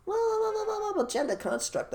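high squeaky mock voice of the applicant; mocking imitation. Host mocks applicant's protest in high squeaky voice. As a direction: pitch it way up, mocking.

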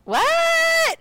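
high, loud shocked "What?". Retold or imitated. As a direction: gasp it out in total disbelief. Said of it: "they were like".

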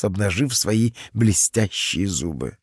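usual speaking voice

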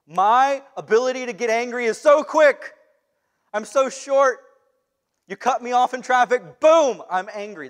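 loud, quick-tempered outburst; a hammed-up angry rant; mock hothead. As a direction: play the short fuse with a burst of volume. Acts out a hot-tempered person.

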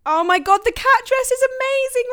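squealing high and loud with delight; excited gush. Real, not acted. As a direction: gush over the photo like a thrilled friend. Gushing over Reggie's photo.

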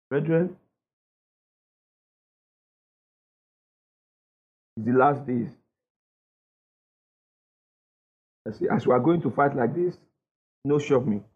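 mock timid general. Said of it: "He now begins to cry".

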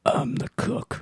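whispered, close on the mic; leaning into the mic. Shown as a demonstration. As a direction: breathe it into the mic, dead serious. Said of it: "lean into the mic and just very seriously say".

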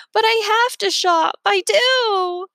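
high, loud whiny protest through tears; a touch of sobbing plea; weepy plea. A narrator's reading. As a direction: beg like a crying woman. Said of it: "sobbing, shoulders shaking".

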